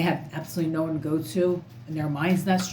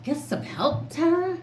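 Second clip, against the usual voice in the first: mocking repeat; 11 semitones higher than usual. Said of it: "Second off".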